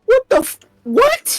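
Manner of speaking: high, loud incredulous what; stunned. Real, not acted. Game master stunned by player's smoke bomb. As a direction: squeak out disbelief.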